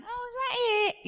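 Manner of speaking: high-pitched mimicked question; mock nosy reaction. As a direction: put on a high voice for nosy people.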